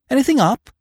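a light, high query; lightly voiced Freddy. A narrator's reading. Freddy asks Eve what is up, lightly voiced.

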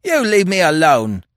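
high, loud retort; defiant. A narrator's reading. Prisoner wrenches free from detective, defiant. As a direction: push back hard. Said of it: "he said defiantly".

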